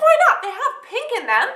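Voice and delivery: high protest of disbelief; indignant. Retold or imitated. To her boyfriend about the pink shirts, high indignant protest. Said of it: "I was like".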